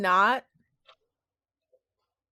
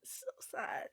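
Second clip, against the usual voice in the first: breathy whispered sigh of sadness; 20 dB quieter than usual. Acted. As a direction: whisper it like it hurts. Echoing sadness softly.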